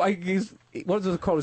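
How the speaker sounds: ordinary delivery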